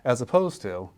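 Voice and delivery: normal talking voice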